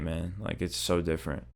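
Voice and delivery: usual speaking voice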